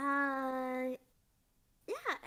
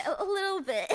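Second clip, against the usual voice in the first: laughing admission; 6 semitones higher, 5 dB louder than usual. Real, not acted. Sheepish admission while laughing.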